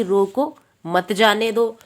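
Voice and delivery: stretched रोको, break, then मत जाने दो run together; group मत with जाने दो after a pause on रोको. Shown as a demonstration. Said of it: "एक वाक्य हमारा ये हो गया". (रोको के बाद विराम, मत जाने दो साथ में)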